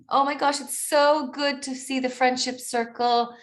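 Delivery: high, bubbly burst of recognition; excited. Real, not acted. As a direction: gush with real excitement at spotting friends. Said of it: "I'm gonna get excited".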